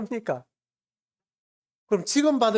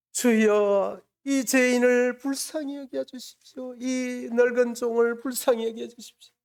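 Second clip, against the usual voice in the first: high, monotone pleading chant; imitated tearful prayer; 6 semitones higher, slower than usual. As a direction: mimic an old mother begging the Lord for mercy. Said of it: "그렇게 눈물을 흘리면서 기도하고 계시는 거예요". (애원하는 어머니 기도를 높은 톤으로 흉내)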